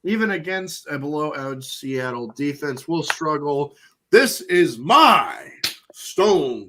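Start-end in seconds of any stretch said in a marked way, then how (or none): 4.1-6.7 dramatic announcement. Acted. Announcing his lock pick.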